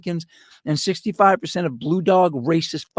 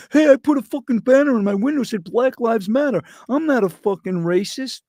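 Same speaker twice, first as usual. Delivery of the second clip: mocking virtue signaler; 6 semitones higher than usual. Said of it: "that's fighting the good fight".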